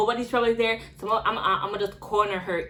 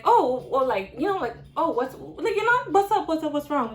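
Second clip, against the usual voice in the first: high, lilting impersonation of a lady; 6 semitones higher than usual. Retold or imitated. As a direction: mimic her in a high, put-on voice.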